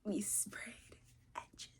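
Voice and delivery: soft stunned whisper; awed whisper. Real, not acted. Stunned by the book, whispering in awe. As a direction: barely breathe it. Admiring sprayed edges.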